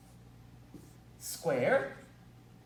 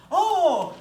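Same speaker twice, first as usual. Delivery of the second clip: mock Euler; 11 semitones higher, 10 dB louder than usual. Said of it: "and he's like".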